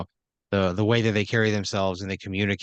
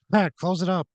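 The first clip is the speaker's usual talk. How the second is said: much higher-pitched call to a colleague; calling out an order; 9 semitones higher than usual. Acted. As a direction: call out a quick instruction on the way out. Michael calls out instructions while leaving.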